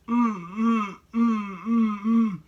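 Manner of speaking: high muffled whimpering mm through a gagged mouth; imitated muffled crying. Caller's victim crying through a gag, imitated high and muffled. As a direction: mimic a gagged woman crying in the background. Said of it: "literally sounding like".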